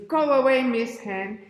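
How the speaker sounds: loud, high dismissive cry; fox ordering off. Acted. Fox sends Miss Hen away, loud and high. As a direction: shoo the hen away loudly. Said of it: "She says".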